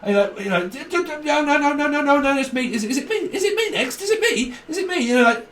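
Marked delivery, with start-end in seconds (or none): 0.7-5.0 much higher, squeaky mock pleading; frantic is-it-me whine; desperate turn-seeker. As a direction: play someone bursting to be next. Mocking someone desperate for their turn.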